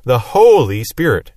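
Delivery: HOLY stretched out slow and punched; stress on Holy. Shown as a demonstration. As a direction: linger hard on Holy, then let Spirit fall. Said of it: "it's throwing emphasis on his holiness".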